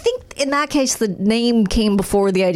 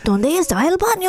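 put-on higher, scratchy kid voice in Spanish; scratchy little boy; 6 semitones higher than usual. Imitating the Duolingo boy character, scratchy child voice. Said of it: "like, scratchy voice way".

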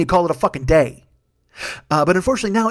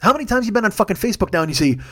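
ordinary talk

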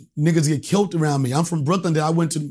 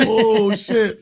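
laughing oh no; 6 semitones higher, 4 dB louder than usual. Retold or imitated. Caught out about his day job.

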